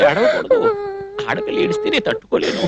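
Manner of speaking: very high, helpless pleading; distressed soothing. Acted. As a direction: beg the crying listener to stop, voice pitched right up. Distressed, begging her not to cry.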